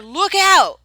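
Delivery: very loud, high alarm cry; shout. A narrator's reading. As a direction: bellow the warning. Driver warning of stampede.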